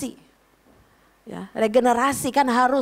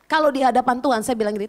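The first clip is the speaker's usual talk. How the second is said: normal talking voice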